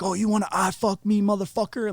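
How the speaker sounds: high-pitched mock challenge; in-your-face confrontation. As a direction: imitate a guard getting in someone's face. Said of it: "in your face".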